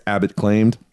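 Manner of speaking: ordinary delivery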